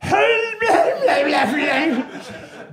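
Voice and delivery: high garbled laughing squawk; a panicked nonsense noise instead of words; strangled noise. Retold or imitated. Caught on the toilet, a panicked high squawk. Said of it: "it just came out as".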